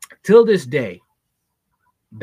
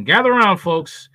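loud street performer hustle; 6 semitones higher, 6 dB louder than usual. Acted. Story time call to gather listeners.

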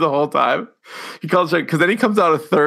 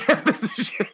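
laughing; 9 semitones higher, 5 dB quieter than usual. Real, not acted. Laughing while quoting.

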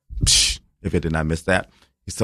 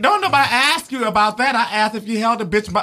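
much higher mock outrage; imitating an exasperated interviewer, higher; 14 semitones higher than usual. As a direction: throw up your hands in disbelief. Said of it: "You'd be like".

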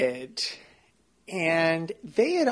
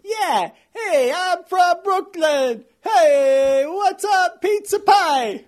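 loud, high, hammed-up Brooklyn accent; thick Brooklyn accent; 10 semitones higher, 6 dB louder, slower than usual. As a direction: ham up a thick Brooklyn tough guy. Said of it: "I can hear your thick Brooklyn accent".

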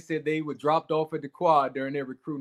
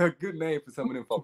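chuckling through the words; laughing; 2 semitones higher, 4 dB quieter than usual. Real, not acted. Host laughing at his own story. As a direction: laugh it out while talking.

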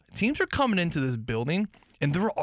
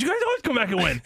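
quick, higher mock of the wife's voice; 10 semitones higher, faster than usual. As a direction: tease her line up high and fast. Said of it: "She goes".

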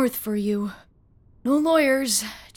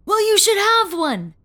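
higher, louder worked-up outburst; a worked-up old man insisting; exclaimed; 8 semitones higher, 4 dB louder than usual. A narrator's reading. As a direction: blurt it out, suddenly worked up. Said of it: "he exclaimed".

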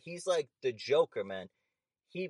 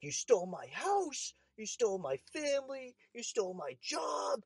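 mock screaming rant; 11 semitones higher than usual. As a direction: drag out each accusation in a high wail.

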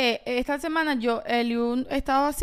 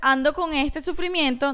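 ordinary talk

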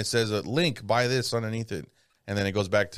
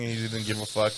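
ordinary talk

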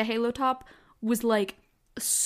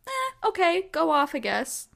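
mock shrug; 8 semitones higher than usual. Real, not acted. Dismissing her old self.

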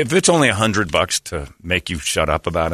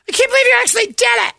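very high indignant falsetto; hammed-up shocked girly whine; mock outraged girl; 4 dB louder than usual. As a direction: squeal your disbelief like a soaked girl. Girl after being thrown in.

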